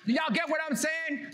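ordinary talk, nothing marked

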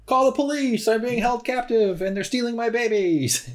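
high frantic cry for help; high-pitched panicked lion. Acted. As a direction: squeal it like a captive lion mother. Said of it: "lion speak for".

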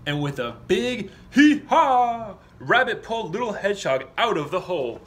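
loud, high strained hee-haw; straining loud storyteller burst; big effortful heave. Acted.

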